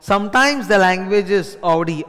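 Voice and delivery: ordinary talk, nothing marked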